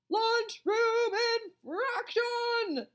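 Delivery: high, loud, drawn-out announcement; bullhorn bellow. A narrator's reading. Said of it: "she bellowed through her bullhorn".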